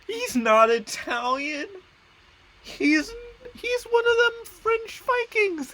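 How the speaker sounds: very high, loud, drawn-out squeal; mock outrage. Gamer finding the ruler is no Italian, mock outraged squeal. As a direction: shriek it in hammed-up disbelief.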